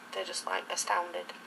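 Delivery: ordinary talk, nothing marked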